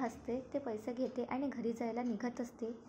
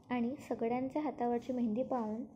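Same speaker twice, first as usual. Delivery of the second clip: plain reading voice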